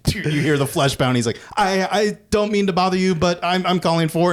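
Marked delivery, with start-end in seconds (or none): 1.6-4.3 high-pitched, apologetic mock stammer; awkward caller. Telemarketer calling a couple having sex, awkwardly apologetic. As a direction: imitate a flustered telemarketer, pitched well up.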